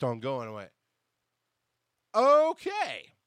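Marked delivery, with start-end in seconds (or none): 2.1-3.1 much higher and louder, a put-on disbelieving okay; stunned okay. Left holding the mic, a mock stunned okay. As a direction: play your own shock, big and high. Stunned reaction to Audrey's delay.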